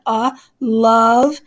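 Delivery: "love" said slowly with a short schwa "uh" vowel; schwa vowel demo. Shown as a demonstration. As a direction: stretch out "uh, love" to show the schwa. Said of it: "The O sound there was probably an uh, a schwa sound".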